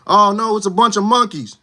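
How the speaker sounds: high, loud mock alarm; put-on outcry of dismay.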